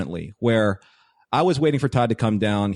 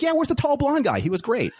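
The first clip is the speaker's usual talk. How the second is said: much higher, bright imitation of Michelle; Michelle's eager question. Wife asking after the tall blonde friend, high and eager. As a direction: pitch it up like an excited fan-friendly wife.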